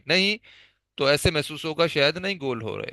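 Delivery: ordinary talk, nothing marked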